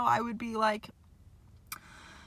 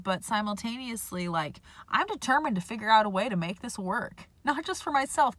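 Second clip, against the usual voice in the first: ordinary talk, nothing marked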